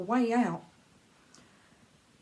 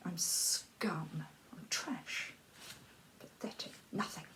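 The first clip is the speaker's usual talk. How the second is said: unvoiced and fading softer; self-loathing whisper; 3 semitones lower, 10 dB quieter than usual. Telling herself she is worthless, in a whisper. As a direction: whisper each insult lower than the last.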